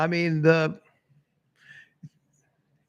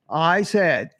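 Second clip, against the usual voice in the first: usual speaking voice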